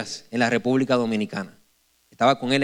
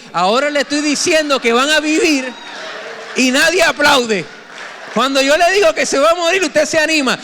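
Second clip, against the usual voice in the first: much louder, higher preaching shout; preaching at full force; 10 semitones higher, 6 dB louder than usual. Acted. Pastor chiding the congregation for not applauding, full preaching shout.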